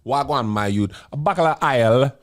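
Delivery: hammed-up Jamaican accent. Shown as a demonstration. Showing co-hosts his Jamaican accent on request, hammed-up Jamaican. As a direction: put on a playful Jamaican patois. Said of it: "My Jamaican accent".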